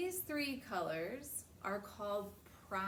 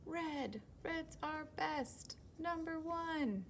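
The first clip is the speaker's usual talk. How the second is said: high, slow boastful voice; proud little show-off; bragging red; 8 semitones higher, slower than usual. Acted. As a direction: boast to the crowd. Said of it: "He says".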